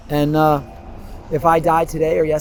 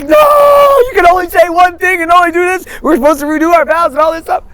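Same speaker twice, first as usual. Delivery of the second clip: exasperated wife; 12 dB louder than usual. Retold or imitated. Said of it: "And she's like".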